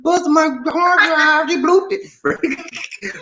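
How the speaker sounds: high, laughing words; real laughter while teasing her. Real, not acted. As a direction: talk through the giggles. Laughing about bloopers.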